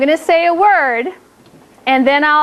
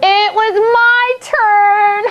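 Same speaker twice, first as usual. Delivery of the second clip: slow, drawn-out, higher-pitched teasing boast; playful gloating; 4 semitones higher, much slower than usual. Pretend. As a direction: crow it slowly at the kids. Teacher gloats over winning a point.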